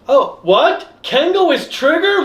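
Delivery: high-pitched astonished echo; mock disbelief. Second character echoing the announcement, in mock disbelief. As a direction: repeat it as a stunned question. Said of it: "repeat a line in disbelief".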